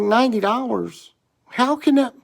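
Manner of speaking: high, incredulous cashier voice; mock disbelief. Imitating the cashier amazed at ninety dollars, high incredulous voice. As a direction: imitate her gasping at the price. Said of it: "She's like".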